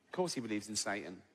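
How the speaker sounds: ordinary talk, nothing marked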